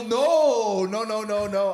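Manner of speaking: loud, high, drawn-out mock protest; flustered denial. Telling the lady he was not flirting, hammed-up fluster. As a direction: imitate a panicked backpedal.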